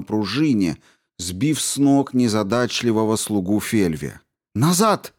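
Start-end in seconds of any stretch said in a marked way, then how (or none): 4.5-5.1 loud sharp high command; sharp shout. Acted. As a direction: bark the order sharply. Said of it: "Резкий крик".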